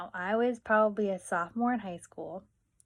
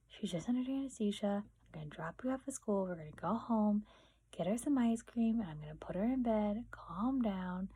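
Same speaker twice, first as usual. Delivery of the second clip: reassuring mom; 7 dB quieter than usual. Retold or imitated. Imitating mom calming her crying daughter, soft reassurance. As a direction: settle the panic in a softer voice.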